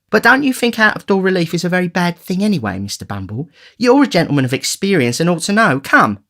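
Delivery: high, flattering lady's voice; butter up the gentleman; coaxing matron. Acted. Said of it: "matron voice".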